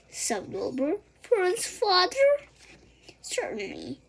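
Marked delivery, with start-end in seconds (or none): 1.2-2.3 high, loud shocked cry; horrified cry. A narrator's reading. Wilbur learning who'll kill him, a shocked cry. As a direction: cry out in alarm.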